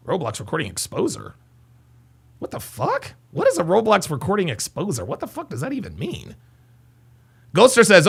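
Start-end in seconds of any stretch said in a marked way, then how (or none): 0.1-6.4 very soft, puzzled aside; quiet, bewildered questioning; baffled muttering. Real, not acted. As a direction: mutter it to yourself in disbelief. Baffled by viewer's odd username.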